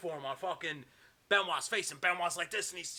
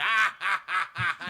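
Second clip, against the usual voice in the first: loud, higher staccato ha-has; mock villain laughter; 4 semitones higher, 9 dB louder, slower than usual. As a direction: henchmen cackling, acted out. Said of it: "Barbarian and Conan are laughing".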